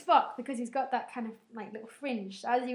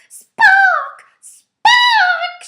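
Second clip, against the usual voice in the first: much higher, much louder screaming; frantic shouting; 23 dB louder than usual. Retold or imitated. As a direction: scream his name across the field. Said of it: "She does a lot of shouting".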